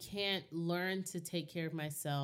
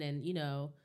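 usual speaking voice

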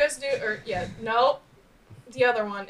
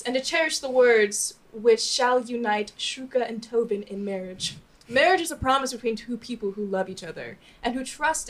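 measured, solemn ceremony reading; formal officiant speech; 3 semitones lower, 3 dB quieter than usual. Acted. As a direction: read the vows like an officiant. Officiant reads the wedding vows.

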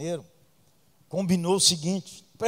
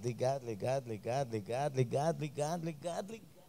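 ligado repeated ever softer until it dies away; fading repetition; 6 semitones lower, 12 dB quieter than usual. Acted. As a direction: let the repeats fade out.

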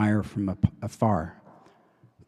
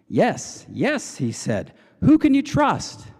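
excited; 12 semitones higher, slower than usual. Retold or imitated. Director eagerly seizes on the question.

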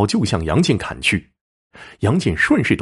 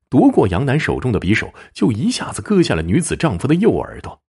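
usual speaking voice

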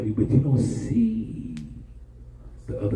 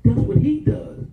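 full-force preaching; 13 semitones higher than usual. Acted. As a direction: preach it to the rafters.

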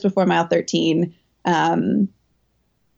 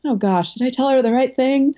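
worried coach; 5 semitones higher than usual. Retold or imitated. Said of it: "he's like".

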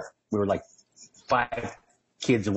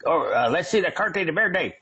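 border patrol agent; 8 semitones higher than usual. Retold or imitated. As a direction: play the officer demanding papers, hammed up. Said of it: "they walk up with that whole".

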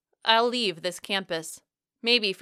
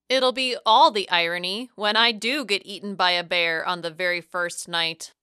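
ordinary delivery, nothing marked

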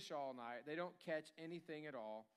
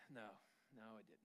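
low, very quiet admission of defeat; deflated, barely voiced reply; dejected; 6 semitones lower, 14 dB quieter than usual. Worker back from a failed deal, dejected. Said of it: "your shoulders all the way down by your ankles".

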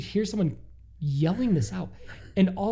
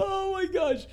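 very high squealed laughing words; breathless with laughter. Real, not acted. Host reacting to the toilet story, high breathless laughing squeal. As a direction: squeal it through uncontrollable laughter. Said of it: "He can't even breathe".

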